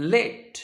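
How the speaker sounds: লেড with a short e vowel; লেড rhyming with bed; noun pronunciation. Shown as a demonstration. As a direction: say লেড as led, the metal. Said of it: "উচ্চারণ হবে".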